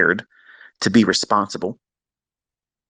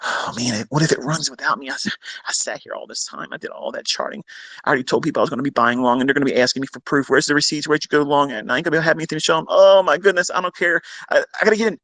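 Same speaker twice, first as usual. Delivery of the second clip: hammed-up fretting, climbing higher and faster; agonised impatient trader; 6 semitones higher, faster than usual. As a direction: act out an impatient trader working himself up. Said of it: "It's agony".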